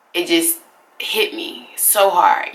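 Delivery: ordinary delivery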